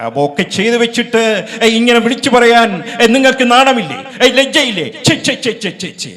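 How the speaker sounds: hammed-up hero's indignant rebuke; Prem Nazir outraged. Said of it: "Prem Naseer". (Prem നസീറിന്റെ ഉച്ചത്തിലുള്ള ശകാരം)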